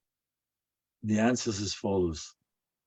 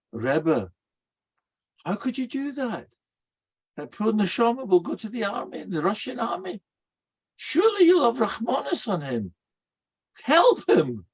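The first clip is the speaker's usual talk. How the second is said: pleading student; 9 semitones higher than usual. Retold or imitated. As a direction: beg the Rebbe for mercy.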